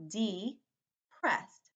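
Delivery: "Depressed" split by a long pause, De... pressed; "Depressed" slowly taken apart into two syllables; broken into syllables. Shown as a demonstration. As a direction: say "Depressed" as two separate parts for spelling. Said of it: "if you break it into syllables".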